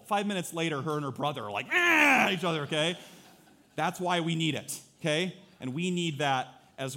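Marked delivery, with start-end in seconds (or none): 1.7-2.3 sudden high loud frustrated yell; mock kids' yell. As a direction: squawk like squabbling siblings. Siblings yelling at each other.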